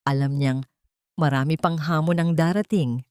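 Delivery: usual speaking voice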